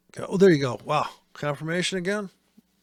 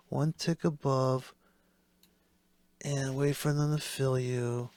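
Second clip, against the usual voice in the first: slow, drawn-out patronizing repeat; slow condescending guru; slower than usual. Retold or imitated. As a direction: spell it out slowly like to a child. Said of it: "And I can't talk like him".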